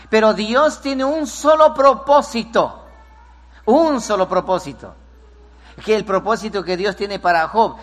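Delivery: loud, high, emphatic sermon delivery; full force preaching. Acted. Pastor preaching on Job to the church, fervent and loud. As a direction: drive it home to the congregation.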